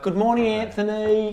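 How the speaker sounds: put-on high, loud New Zealand accent; Kiwi accent. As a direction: greet him in a Kiwi accent. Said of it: "speaking to each other in a Kiwi accent".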